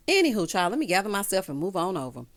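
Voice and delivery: ordinary talk, nothing marked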